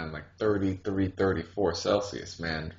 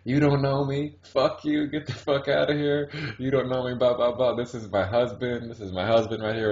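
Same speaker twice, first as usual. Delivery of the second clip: mock indignant rant, pitched up; retold tirade, high-pitched and easing down toward the end; going off on someone; 5 semitones higher than usual. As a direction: imitate a woman telling someone off, higher voice. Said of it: "starts to go off on him".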